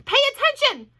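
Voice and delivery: high, loud yell; screaming. A narrator's reading. Wilbur screaming at Templeton, high and loud. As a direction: yell it right in his face. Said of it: "screamed Wilbur".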